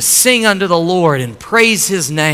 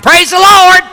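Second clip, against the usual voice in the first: joyful shouting; 10 dB louder than usual. Retold or imitated. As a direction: holler it from the front porch. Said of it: "she'd just start shouting".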